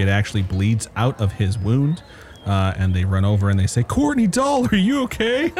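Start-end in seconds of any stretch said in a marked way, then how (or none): 3.8-5.5 high-pitched worried call; high concerned cry. Acted. Bystanders call to the cut gentleman, high mock alarm. NPCs call to wounded man.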